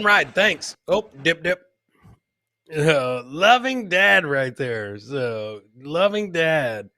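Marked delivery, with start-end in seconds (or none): none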